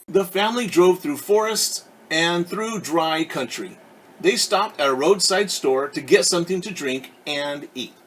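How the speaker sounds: clear, lively read-aloud voice; storyteller reading a picture book; story narration. A narrator's reading. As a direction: read it to a class of kids.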